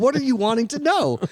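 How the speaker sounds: high, strained exasperated question; mock-exasperated high whine. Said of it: "I was like".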